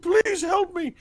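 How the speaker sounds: high, loud hammed-up plea for help; mock pleading. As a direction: beg like a wounded paladin, played for laughs. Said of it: "the paladin's going".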